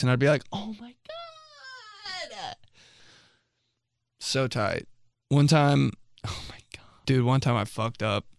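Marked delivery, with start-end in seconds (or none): 0.5-2.6 squealed very high and slow, almost crying with joy; overjoyed squeal. Retold or imitated. Kid overjoyed at Wendy's offer. 6.3-6.8 breathy whisper of dread. Real, not acted. Recalling a mess-up, whispered.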